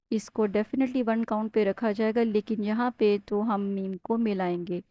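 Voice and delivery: normal talking voice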